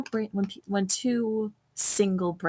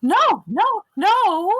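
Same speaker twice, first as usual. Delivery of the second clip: alarmed; 13 semitones higher, 5 dB louder, much slower than usual. Real, not acted. Alarmed denial at heal mixup.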